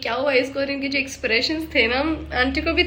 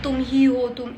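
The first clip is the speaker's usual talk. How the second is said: normal talking voice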